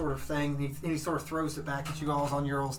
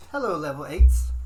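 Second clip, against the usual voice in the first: paladin greeting; 11 dB louder than usual. Acted. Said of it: "He goes".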